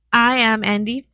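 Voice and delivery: usual speaking voice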